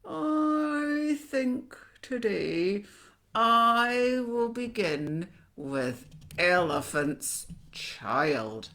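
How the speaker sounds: very slow, loud, clenched-teeth menace; toothy crocodile relishing a meal; crocodile talking between its teeth. A narrator's reading. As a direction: draw it out through shut teeth, falling at the end. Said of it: "he said it between his teeth like this".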